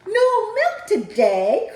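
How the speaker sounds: high, loud, slow outcry; crying out. Acted. Farmer Brown in the background, high loud cry. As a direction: wail it in disbelief. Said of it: "cried Farmer Brown".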